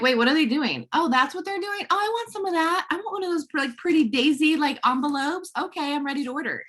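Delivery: high, eager mock customer reaction; excited onlooker. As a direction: act out curiosity turning into wanting it. Acts out intrigued viewers getting hooked.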